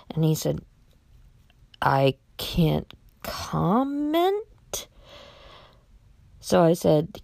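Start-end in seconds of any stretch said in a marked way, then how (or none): 1.8-4.5 drawn-out, hesitant words rising high at the end; coy non-answer. Retold or imitated. Utility worker dodging a caller's question, coy. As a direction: stretch it out slowly, lifting into a question.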